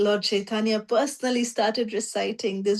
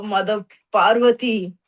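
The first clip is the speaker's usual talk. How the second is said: usual speaking voice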